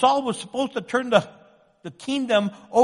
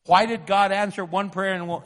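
ordinary talk

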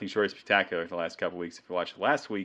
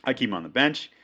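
normal talking voice

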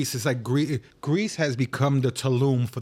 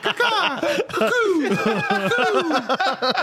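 high, laughing cuckoo call; bird call imitation; much slower than usual. Mimicking his friend's cuckoo, imitating a bird call. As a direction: coo it like a parrot. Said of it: "making African gray noises".

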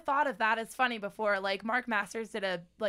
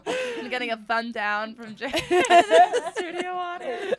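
breathless with laughter; laughing; 9 semitones higher, 11 dB louder than usual. Real, not acted. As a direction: talk through a laughing fit. Laughing after pun.

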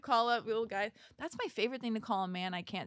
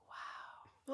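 whispered wow; 14 dB quieter than usual. Retold or imitated. Stunned reaction retold.

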